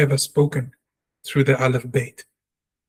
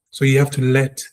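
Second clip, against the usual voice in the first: ordinary talk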